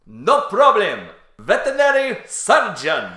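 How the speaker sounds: loud, drawn-out foreign butcher voice; high, slow, blaring foreign accent. Acted. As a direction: boom it out slowly as a hammed-up foreign butcher. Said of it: "imagine, like, a foreign butcher".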